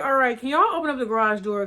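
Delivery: loud plea. Retold or imitated. Begging cousins to open door.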